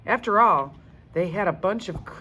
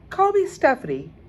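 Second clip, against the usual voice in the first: high, loud, chirpy Stephanie voice; a bright eager guide greeting kids; museum lady; 9 semitones higher, 8 dB louder, faster than usual. Acted. Museum lady introduces herself.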